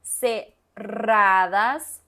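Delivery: cerradas with a long, loud trilled rr; exaggerated teacher trill; strong rolled r. Shown as a demonstration. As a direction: roll the rr in cerradas hard and slow. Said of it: "está bien hablar la R como muy fuerte".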